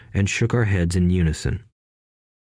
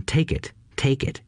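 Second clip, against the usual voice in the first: high, urgent plea without volume; mock inner scream; 5 semitones higher, 3 dB quieter than usual. A narrator's reading. Boy's brain urging him to accept the raise, high mock scream. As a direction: scream it small, inside your head. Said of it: "My brain was screaming".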